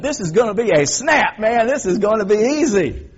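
high, loud and cocky, sure it's all easy; smug preacher imitating easy success; cocky boast. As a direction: crow that this will be a breeze. Confident boast, ministry going well.

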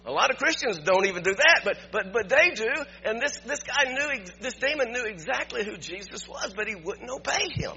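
preaching. Acted. Preaching builds in intensity.

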